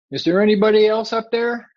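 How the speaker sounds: high, loud call upward; acted calling out. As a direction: call past God for someone else. Man calls up for someone else.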